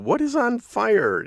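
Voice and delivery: high, slow, emphatic demand; exasperated question. Retold or imitated. Husband trying to learn what is burning, exasperated. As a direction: pitch leaps up in mock bewilderment.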